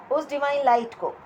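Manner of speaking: usual speaking voice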